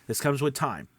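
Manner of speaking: usual speaking voice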